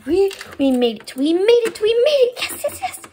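celebrate, building to breathless yeses; excited. Pretend. Relief at arriving on time.

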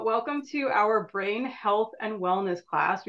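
normal talking voice

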